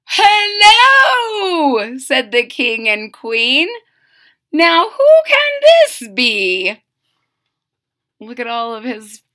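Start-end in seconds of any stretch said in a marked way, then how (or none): 0.1-2.0 high shouted greeting; royal hello. Acted. The king and queen cry hello to the boy. 4.5-6.8 slow, loud, wondering; royal puzzlement. Acted. The king and queen wonder who has come.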